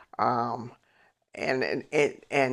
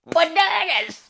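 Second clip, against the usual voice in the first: bananas stretched high, slow and loud; emphatic bananas; 6 dB louder, slower than usual. Real, not acted. Telling how her mother reacted, bananas drawn out loud. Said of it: "My mother went".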